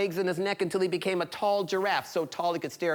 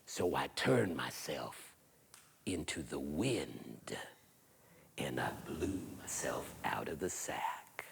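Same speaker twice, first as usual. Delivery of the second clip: eerie whisper; 11 dB quieter, slower than usual. Acted. Hairy man explains magic.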